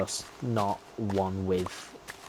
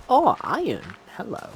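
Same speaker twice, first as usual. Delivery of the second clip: sudden high, loud delight; excited find; 12 semitones higher, 7 dB louder than usual. Real, not acted. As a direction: yelp with joy at the ore. Finds iron while mining.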